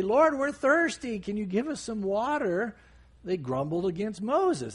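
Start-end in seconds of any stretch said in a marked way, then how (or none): 0.0-2.8 higher-pitched mock plea; lightly acted appeal for help, pitched up; calling out to God. Israelites asking God for water, calling out. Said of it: "calling out to God".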